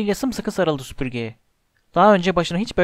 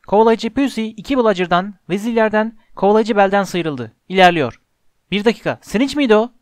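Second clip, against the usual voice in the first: sports commentary; 3 semitones higher, 4 dB louder than usual. A narrator's reading. Lee Jordan calling Slytherin's attack, excited commentary. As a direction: call the attack, ending in startled doubt.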